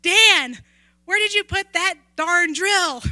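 loud calling shout across the house; retold shout. Widow calling to her late husband about the drill, real shout. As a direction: yell it out like calling someone in another room. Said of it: "And she shouted out".

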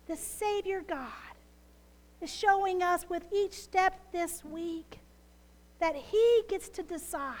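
usual speaking voice

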